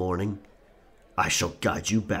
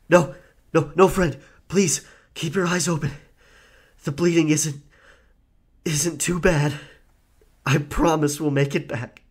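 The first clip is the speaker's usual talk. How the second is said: higher, urgent pleading; desperate pleading; 6 semitones higher, 3 dB louder than usual. Acted. Pirate begging his bleeding friend to keep his eyes open, desperate. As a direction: beg him to stay awake.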